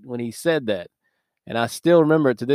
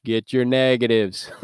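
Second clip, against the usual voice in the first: slow, louder Southern drawl of a gym coach; Southern coach accent; 4 dB louder than usual. Retold or imitated. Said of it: "Just like that coach".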